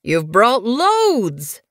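slow, drawn-out, pleased exclamation; delighted. Acted. Old medicine cat greeting the apprentices, slow and delighted. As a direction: savour the praise as they arrive. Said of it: "Goosefeather was delighted".

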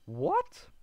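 surprised what. Real, not acted. Confused gamer.

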